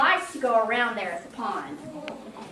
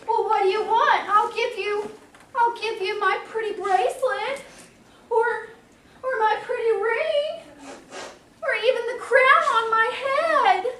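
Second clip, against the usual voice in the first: pleading princess; 7 semitones higher than usual. Acted. Princess pleads, offering frog gifts.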